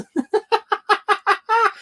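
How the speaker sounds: squealing helpless laughter; high-pitched breathless giggle; high cry-laugh. Real, not acted. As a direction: wheeze with laughter. Laughing hard at the scene.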